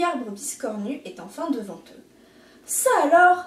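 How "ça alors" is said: loud, higher astonished cry; exclaiming. A narrator's reading. Rooster seeing the cactus, loud surprise. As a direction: gasp it out amazed. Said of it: "s'exclame le petit coq".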